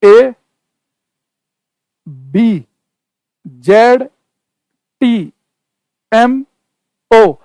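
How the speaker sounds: each letter apart, with long gaps between; letters with pauses. Shown as a demonstration. Teacher spaces out letters for the students. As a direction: space every letter out clearly. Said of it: "मैंने कहा".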